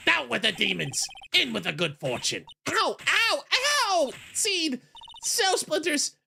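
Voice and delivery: put-on high yelping old man.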